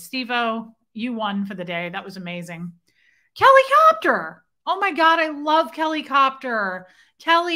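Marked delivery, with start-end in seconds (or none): 3.3-4.4 high, loud squeal of a name; excited greeting. Real, not acted. Greeting friend Kelly as she joins, excited squeal. 4.7-7.6 high, loud delight, slowing on the name; excited gushing. Real, not acted. Gushing over friend Kelly joining, excited.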